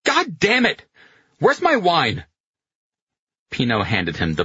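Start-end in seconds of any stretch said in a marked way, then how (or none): 0.0-2.2 high, exasperated yell; angry cursing. A narrator's reading. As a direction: curse and demand in a furious high voice. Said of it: "Carletto said angrily".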